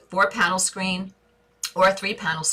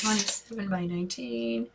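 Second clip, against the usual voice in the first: soft, higher-pitched thinking aloud; musing to herself; 4 semitones higher, 6 dB quieter than usual. Real, not acted. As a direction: turn the figures over softly, pitched up. Still mulling the figures to herself.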